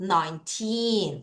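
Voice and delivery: put-on Nineteen with a long, strong TEEN; nine-TEEEN, ending held; stress on TEEN. Shown as a demonstration. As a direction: stretch the second syllable of Nineteen. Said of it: "틴을 강하게 읽겠습니다".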